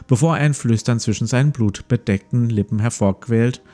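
ordinary talk, nothing marked

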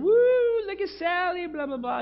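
very high, loud party cheer; party whoop. Retold or imitated. Old high school friends cheering at a party, high whoop. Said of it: "They were celebrating back then".

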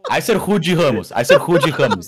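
laughing protest. Real, not acted. Defending a misheard street name, laughing protest. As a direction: repeat it loudly while cracking up.

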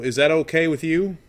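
normal talking voice

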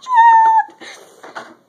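very high-pitched squealing outburst; excited squeal. Real, not acted. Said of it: "I'm so excited".

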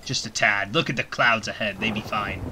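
lightly voiced pirate captain, a hint of gruff. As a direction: play a sea captain steering through a storm. Pirate talk in Sea of Thieves.

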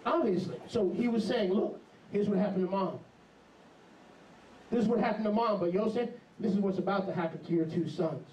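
ordinary delivery

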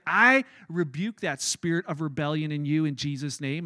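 low, solemn mock-exorcist pronouncement; imitated stern rebuke. Mom rebukes her mouthy teenager, imitated stern rebuke. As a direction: imitate a mother firmly casting out rebellion.

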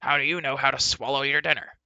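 loud, irate dwarf voice; indignant, loud comeback; Dolly, snapping. A narrator's reading. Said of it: "snapped Dolly".

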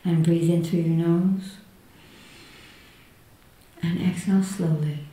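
hushed and soothing, guiding inward. Acted. Leads a guided meditation.

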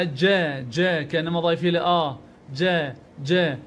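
"ج" as an open "jaa"; fatha sound. Shown as a demonstration. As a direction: sound "ج" as if an alif follows it. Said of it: "بالفتحة".

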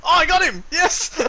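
high, loud victory cry; excited triumph. Real, not acted. Player telling teammate about a kill, excited cry. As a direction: shout it out, elated.

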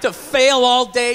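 ordinary delivery

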